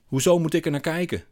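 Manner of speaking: loud, high indignant question; calling out. A narrator's reading. Man protests to narrator at the bar, calling out. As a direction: cry it out in protest. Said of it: "roept hij".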